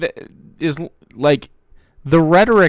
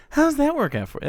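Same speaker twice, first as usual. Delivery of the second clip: much higher, squeaky mock of his line; mocking the catchphrase. Mocking the streamer's catchphrase in a much higher squeak. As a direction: parody how he 'does a voice'.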